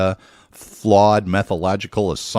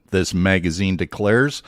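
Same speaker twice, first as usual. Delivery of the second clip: ordinary delivery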